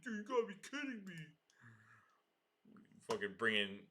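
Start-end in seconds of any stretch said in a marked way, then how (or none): 0.0-1.1 high, fast imitation of an annoyed buddy; mock exasperated friend. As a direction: rattle it off, pitched up, imitating his disbelief. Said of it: "He's gonna be like".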